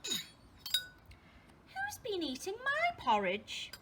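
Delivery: slow, high-pitched question from a mummy bear; Mother Bear. Acted. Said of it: "in a medium-sized voice".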